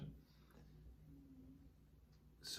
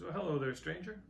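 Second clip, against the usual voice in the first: cheerful greeting; 6 semitones higher, 5 dB louder than usual. Retold or imitated. Old man greets second traveler.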